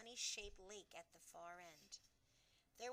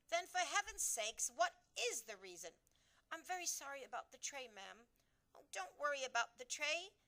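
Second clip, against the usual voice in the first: higher, louder urgent royal voice; impatient Queen; 5 semitones higher, 7 dB louder than usual. A narrator's reading. Queen demanding why the maid dropped the tray. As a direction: press the maid for the reason.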